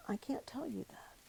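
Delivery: a choked, hushed confession; soft, breathless whisper, afraid to say it; tearful whisper. Retold or imitated. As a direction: whisper it through tears, barely voiced. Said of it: "And I started crying".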